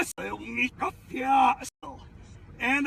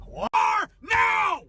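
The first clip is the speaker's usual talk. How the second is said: high, loud angry yell; loud sharp voice; 8 semitones higher, 3 dB louder than usual. Acted. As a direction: snap it out furiously. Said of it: "Why are you yelling at me?".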